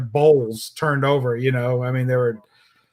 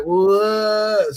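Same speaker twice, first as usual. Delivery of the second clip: high, drawn-out dazed voice; higher, woozy mock reply; messed-up girl; 5 semitones higher than usual. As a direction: imitate someone out of it. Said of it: "she had to be messed up".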